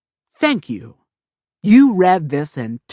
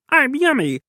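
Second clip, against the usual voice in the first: a much higher, gleeful squeak; delighted squeal; 12 semitones higher than usual. Acted. Kid bragging about his breakfast, squealing with delight. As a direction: relish it like a treat.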